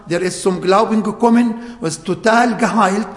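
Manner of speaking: usual speaking voice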